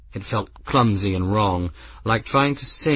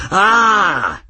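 put-on excited old man; 12 semitones higher, 8 dB louder than usual. Old man greets Grim eagerly.

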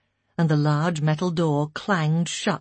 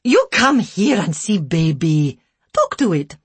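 broken English; 2 semitones higher, 7 dB louder than usual. A narrator's reading. Care worker insisting they see the baby, loud broken English. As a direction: order them over in broken English. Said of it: "in broken English".

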